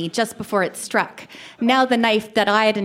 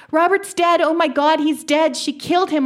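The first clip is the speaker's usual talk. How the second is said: screaming; 7 semitones higher than usual. A narrator's reading. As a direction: scream it out in horror. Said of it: "I screamed".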